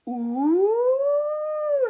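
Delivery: high, loud, drawn-out ooh; impressed ooh. Acted. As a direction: goblins gasp in wonder. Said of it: "They were impressed".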